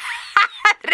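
high, hooting cackle; mocking laugh. A narrator's reading. Boss laughing at his employee's excuse, high cackle.

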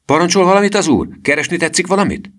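high, loud, overly polite servant voice; eager bellboy. Acted. Boy posing as bellboy greets the thief eagerly. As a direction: snap to attention and ask with fake servility.

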